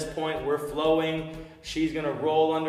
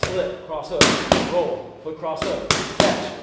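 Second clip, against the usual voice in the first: loud, rising combo calls; calling combos; 2 semitones higher, 5 dB louder than usual. Shown as a demonstration. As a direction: call each punch with growing force. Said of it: "any cues that I have, you guys are gonna hear".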